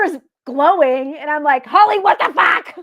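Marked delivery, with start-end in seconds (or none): 1.6-2.7 high, loud mock alarm; startled outburst. Investigator retelling her shock to Holly at the glowing seer, startled yell. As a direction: cry out in retold shock, half laughing.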